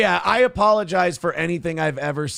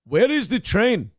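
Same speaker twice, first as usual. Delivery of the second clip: slow, higher mock Indian accent; a second, slower try at the Indian accent; 6 semitones higher than usual. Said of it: "I did my African voice also for Indian".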